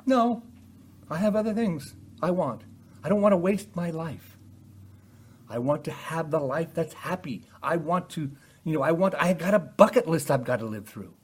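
stubborn sinner. Acted. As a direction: brush God off with your own wants. Preacher voices the defiant heart.

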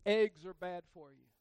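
mock slogan delivered loud. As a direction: bark it like a headline. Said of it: "Have you ever heard this?".

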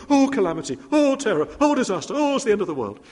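high, loud mock panic wails; panicky Mister Jelly. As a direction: ham up a jittery little man's alarm. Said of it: "he'd go".

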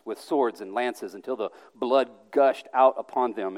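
ordinary talk, nothing marked

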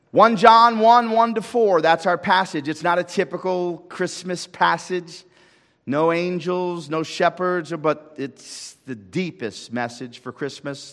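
rousing sermon voice; full-force preaching. Acted. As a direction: proclaim it to the whole church. Preacher announces the passage.

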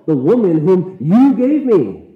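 "you" high and loud, slow and accusing; stress on you. Shown as a demonstration. Adam accusing God in a loud stressed "you". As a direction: throw "you" right back at God. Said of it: "Maybe it's both".

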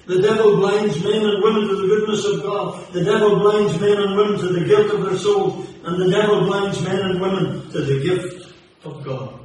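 preacher warning the lost about the devil, fervent and loud; fiery preaching. Acted. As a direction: thunder it at the congregation. Scripture then rising sermon.

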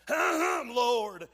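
Martha's loud cry of Lord; calling out the word in protest; shouted cry. Acted. Martha crying out to Jesus, loud.

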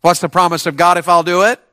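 usual speaking voice

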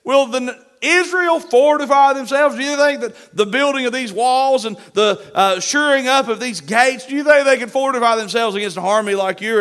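hammed-up jeering enemy rallying troops; mocking Sanballat. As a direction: whip up the soldiers with scorn. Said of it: "and he says".

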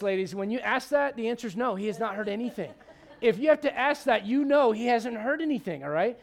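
ordinary delivery

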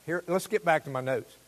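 ordinary delivery

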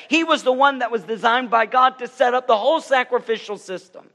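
high, loud preaching build; fiery preaching. Acted. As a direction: preach it out at full force to the room. Preaching at full force.

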